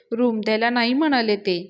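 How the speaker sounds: ordinary delivery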